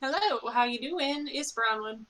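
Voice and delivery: ordinary talk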